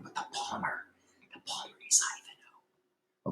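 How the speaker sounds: hushed reveal of a secret; whisper. Real, not acted. Teacher whispering who the palmer really is, hushed. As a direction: whisper it like a spoiler.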